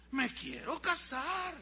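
put-on slow, high, emphatic child's demand; insistent child. Boy repeats marriage wish to doubting dad, slow emphatic insistence. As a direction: stretch each word to prove you mean it. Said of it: "te estoy hablando en serio".

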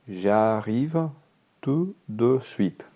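slow, deliberate French for learners; slow reading. Shown as a demonstration. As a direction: spell out each word slowly. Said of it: "leer el diálogo muy despacio". (lectura lenta para alumnos)

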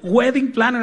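high, loud flourish; showy announcement. Acted. Preacher showing off the English job title, showy announcement. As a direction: announce the title as the last word in importance. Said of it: "así como que, pues, ya".

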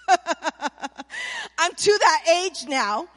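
amused, slow start through a laugh; real laughter. Real, not acted. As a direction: laugh at yourself as you admit it. Laughing at her own age.

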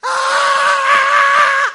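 very high, very loud terrified shriek; ear-splitting mock scream of fright; imitated scream. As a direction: mimic the rat hunter's panicked scream. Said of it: "la expresión de mi amigo, el cazador de ratas, fue esta".

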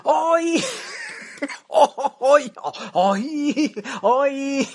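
hyped, stretched cries of hoy; excited shout. Acted. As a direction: call it out like a showman. Host shouting opening announcement.

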